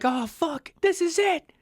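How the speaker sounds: high, strained cry of doom; panicked despair. Retold or imitated. Dad crying out, certain they will die. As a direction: cry out that death has come. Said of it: "the dad was, like, freaking out".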